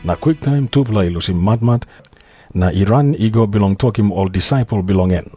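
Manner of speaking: ordinary talk, nothing marked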